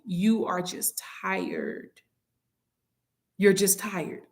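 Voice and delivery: very slow, soft whisper; hushed reassurance. Real, not acted. Preacher consoling worn-out listeners, hushed reassurance. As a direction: let it sink to a slow whisper.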